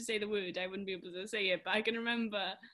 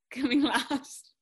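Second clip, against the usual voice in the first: laughing; 3 semitones higher, 9 dB louder, slower than usual. Real, not acted. Laughing while explaining.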